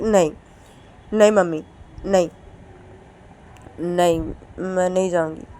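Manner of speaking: loud, insistent no no no; loud refusal. Acted. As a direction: refuse her mother loudly, almost shouting. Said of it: "तो तभी मनीषा बोलती कि". (ज़ोर से मना करती हुई बेटी)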